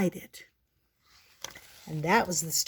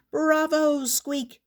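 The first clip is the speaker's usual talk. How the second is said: cheering shout; 8 semitones higher, slower than usual. Acted. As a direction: cheer like a crowd. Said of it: "the audience shouted".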